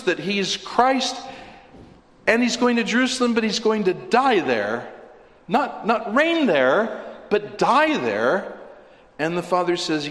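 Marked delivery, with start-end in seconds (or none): 0.6-8.6 fervent emphasis. Acted. Preacher stresses Christ, die.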